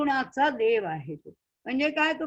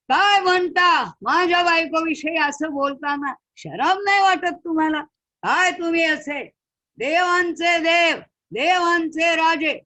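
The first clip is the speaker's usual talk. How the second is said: loud, higher, scandalised; outraged shout; 6 semitones higher, 7 dB louder than usual. Acted. Sage shames the king of gods, outraged shout. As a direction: hurl the words in disgust.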